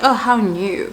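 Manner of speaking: dry, unimpressed how new; a light take on a sarcastic daughter; imitating attitude. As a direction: roll your eyes through it. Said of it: "With an attitude".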